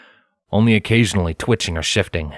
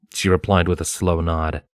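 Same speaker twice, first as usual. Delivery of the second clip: ordinary delivery, nothing marked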